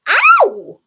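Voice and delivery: high-pitched, very loud cry of pain; high loud yelp. A narrator's reading. Elephant bitten on the leg, yelping high and loud. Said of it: "in his big, deep voice".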